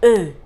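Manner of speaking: E as a loud, rounded French "uh"; French E sound. Shown as a demonstration. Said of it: "Look at the movement of my mouth".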